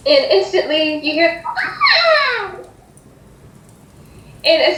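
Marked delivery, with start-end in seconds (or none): 1.5-2.6 high, drawn-out squeal; toddler squeal. Acted. Toddler shrieks as the party walks in, high squeal. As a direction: let out a long, high-pitched child's shriek.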